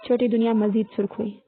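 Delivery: plain reading voice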